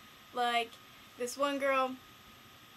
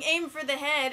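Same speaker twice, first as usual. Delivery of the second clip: loud but less pitched retelling of a yell; half-strength shouted quote; retold yell; 3 semitones higher, 7 dB louder than usual. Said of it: "nobody knows why I'm yelling".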